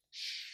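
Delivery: long, hushed shh; soft shushing. A narrator's reading. Narrator hushes the gallery visitors, soft shush. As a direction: shush the listeners gently.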